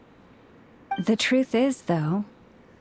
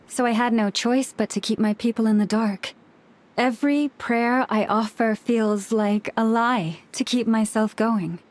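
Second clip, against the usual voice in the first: ordinary delivery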